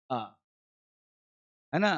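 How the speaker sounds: normal talking voice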